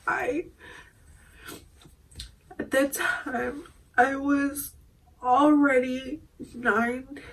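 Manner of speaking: ordinary talk, nothing marked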